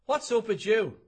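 loud greeting. Acted. Pub banter greeting.

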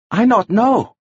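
high halting character voice; Arsuk. A narrator's reading. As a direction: plead ignorance in a high voice.

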